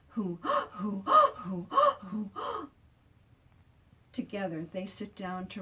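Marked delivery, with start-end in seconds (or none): 0.1-2.7 panting whoos with gasps between; out of breath. A narrator's reading. Charlotte and Otto after the chase, panting breathlessly. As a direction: gasp between each who. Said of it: "out of breath".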